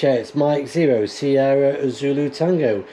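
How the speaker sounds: crisp ham radio callsign read; radio call. Real, not acted. Radio contact call and report.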